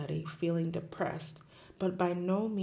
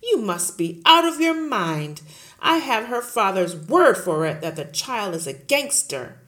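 loud, booming headmistress; bully the teacher at full volume; Trunchbull bullying; 14 dB louder than usual. Acted. Headmistress roaring at Miss Honey, loud and bullying.

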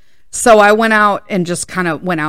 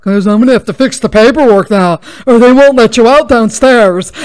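loud, fast indignant clerk voice; loud, higher and fast hammed-up clerk; loud mock clerk; 3 semitones higher, 8 dB louder than usual. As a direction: bark the clerk's excuse back at him. Quoting clerk, heated.

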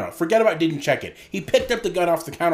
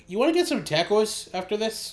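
higher-pitched mock Canadian accent; 8 semitones higher than usual. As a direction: put on a Canadian hoser accent. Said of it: "I can't do that accent like you can".

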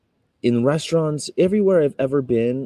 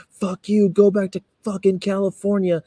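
a high, louder mocking curse; mock angry yell; 10 semitones higher, 5 dB louder than usual. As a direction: play the furious stranger shouting him off. Retold stranger cursing him.

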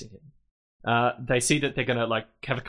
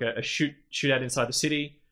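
normal talking voice

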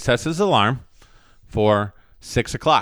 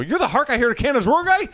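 amazed; 13 semitones higher than usual. Acted. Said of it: "They go".

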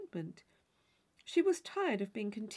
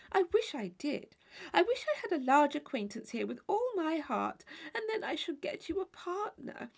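chattering Mrs Allen; 6 semitones higher than usual. Acted.

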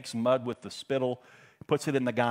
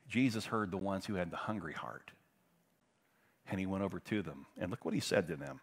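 ordinary delivery

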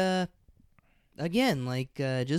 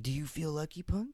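1 Dirty Harry quote; 8 dB quieter, faster than usual. Retold or imitated. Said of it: "quotes Dirty Harry".

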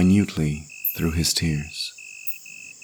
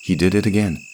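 usual speaking voice

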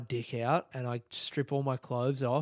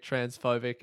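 ordinary talk

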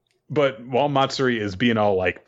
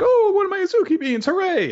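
much higher, fast cartoonish joy; delighted squeal. Finding his lost bean, a much higher fast gleeful squeal. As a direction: squeal with childlike glee.

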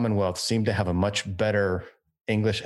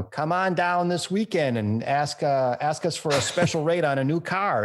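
radio ad voice; 7 semitones higher than usual. Retold or imitated. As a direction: sell it like a car dealer on the radio. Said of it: "what they're saying on the radio".

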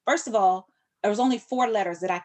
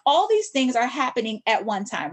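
ordinary talk

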